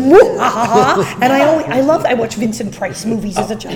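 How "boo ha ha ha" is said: slow evil villain laugh; mustache twirling villain. Retold or imitated. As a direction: cackle like a cartoon villain. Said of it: "twirling the mustache, going".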